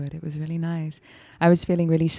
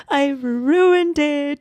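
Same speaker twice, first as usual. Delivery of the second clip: put-on higher, louder, very slow child's wail; inner child voice; 10 semitones higher, 7 dB louder, much slower than usual. Retelling her inner child's wail, high and slow. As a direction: stretch it out like a hurt little kid. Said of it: "this triggered my inner child".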